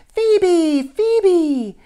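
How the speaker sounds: put-on little boy's foghorn scream across a crowd; screaming a name. A narrator's reading. Child screams for his lost friend, very loud and high. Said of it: "the little boy with the foghorn voice".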